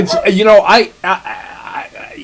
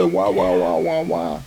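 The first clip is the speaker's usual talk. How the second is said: mock crying wah; 7 dB quieter than usual. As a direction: blubber like a crybaby in mockery. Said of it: "he can send emails to my son and go".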